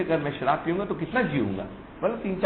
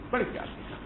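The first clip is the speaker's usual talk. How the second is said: بڑے پیار سے کہا whispered, soft and higher; tender whisper; 8 semitones higher, 8 dB quieter, much faster than usual. Shown as a demonstration. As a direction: whisper it as the loving words themselves.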